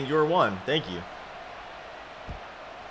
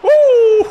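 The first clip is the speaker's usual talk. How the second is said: very high, loud whoop breaking into laughter; screaming whoop; 8 dB louder than usual. Real, not acted. Gamer screaming at his play, high whoop and laugh. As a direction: scream a long woo, then laugh. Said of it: "We're screaming".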